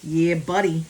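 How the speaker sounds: loud, proud celebration; triumphant cheer. Real, not acted. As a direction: cheer your own success loudly. Cheers own correct answer.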